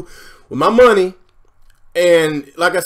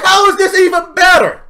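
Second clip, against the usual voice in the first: high loud disbelief; shouted question; 12 semitones higher, 5 dB louder than usual. Real, not acted. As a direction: yell the question. Repeated question.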